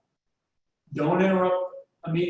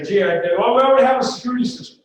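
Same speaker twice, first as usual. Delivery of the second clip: mock prospect, higher and louder, rushed; brushed-off prospect; 6 semitones higher, 7 dB louder, faster than usual. Salesman knocks at competitor's client, prospect brushes him off, higher and louder. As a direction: play the prospect waving the salesman off fast. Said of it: "you get in there and say".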